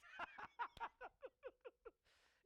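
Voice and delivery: high, loud burst of laughter; laughing at the clingy koala story; long delighted laugh. Real, not acted. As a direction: laugh hard at the joke.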